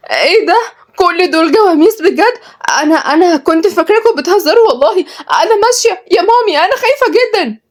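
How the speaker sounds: very high, panicky voice; frightened. Acted. Kenzi facing a barn full of buffaloes, scared. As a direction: back away in squeaky alarm. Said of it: "كنزي عيونها وسعت بفزع وقالت بخوف".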